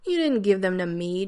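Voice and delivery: loud, hurt shout. A narrator's reading. John shouting at Rodney about the stolen gifts, loud. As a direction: throw it back at him loudly. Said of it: "John shouted".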